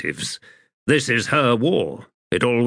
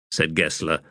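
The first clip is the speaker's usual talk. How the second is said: plain reading voice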